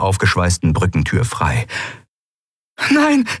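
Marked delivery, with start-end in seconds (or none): 2.9-3.3 high breathless gasp of horror. Acted. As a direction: gasp it out in shock. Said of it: "keuchte Endriel".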